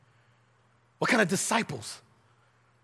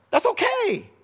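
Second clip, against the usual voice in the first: That's okay pitched much higher, in a put-on voice; playful high-voiced echo; mock reassurance; 4 dB louder, slower than usual. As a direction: float That's okay way up and let it fall. Preacher repeats the phrase in a new voice.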